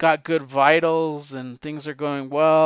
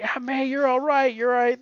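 high, urgent rush of relief; high-pitched imitation of a relieved nephew; acted relieved cry; 10 semitones higher than usual. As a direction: play a nephew overjoyed at the bedside. Voices Peter rushing to his aunt.

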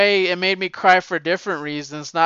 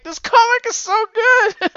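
mock crying; 6 dB louder than usual. Answering wife while crying.

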